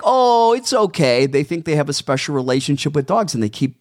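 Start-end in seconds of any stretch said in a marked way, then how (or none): 0.0-1.2 high, loud, breezy brush-off; mock the careless stranger's breezy reassurance; mocking dismissive stranger. Stranger brushing off the owner's warning, loud mocking breeziness. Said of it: "and they're like".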